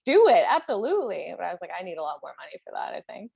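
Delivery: ordinary delivery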